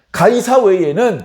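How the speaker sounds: emphatic declaration. Acted. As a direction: proclaim it firmly as the priests.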